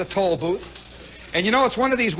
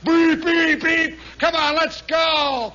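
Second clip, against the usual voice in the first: louder, higher yelling with mock horn beeps; impatient hollering; 7 semitones higher, 3 dB louder than usual. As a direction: honk and holler at the stalled car. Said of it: "my friend is hollering".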